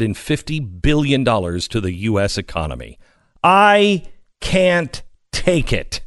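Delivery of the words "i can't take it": high, slow hammed-up anguish; mock despair. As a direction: wail it in fake agony. Mock despair over economic gains.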